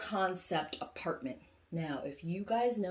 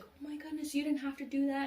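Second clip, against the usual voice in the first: high-pitched, grateful mock astonishment; touched surprise; 8 semitones higher than usual. Husband to the woman who fixed the bear, touched surprise. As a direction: act out a husband pleasantly taken aback.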